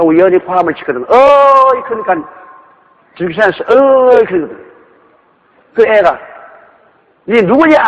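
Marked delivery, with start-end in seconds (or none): 1.0-1.8 high, loud, drawn-out holler; calling out to the mountains. Acted. Child shouts into mountain. 3.7-4.2 raised, loud echo of a holler; echoing call. Acted. Mountain echoes the shout. 7.3-7.8 high, loud challenge across the hills; calling out. Acted. Child calls to the echo.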